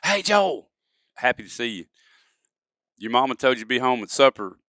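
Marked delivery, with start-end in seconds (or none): 0.0-1.9 glad greeting. Shown as a demonstration. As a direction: greet a long-lost friend with joy. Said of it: "say I hadn't seen you in ten years".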